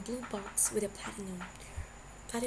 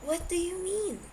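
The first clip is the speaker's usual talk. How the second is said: higher, feigned innocence; mock innocent question; innocently; 10 semitones higher than usual. A narrator's reading. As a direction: play dumb, all innocence. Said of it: "Sebastian started innocently".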